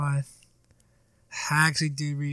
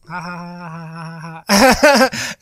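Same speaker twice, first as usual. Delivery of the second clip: mock laugh; 2 semitones higher, 9 dB louder, slower than usual. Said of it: "I'm here, like".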